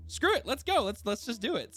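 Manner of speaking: high, eager burst of giving in; excited. Retold or imitated. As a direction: fling it out like screw it, let's go. GM quoting himself, giving up on plans.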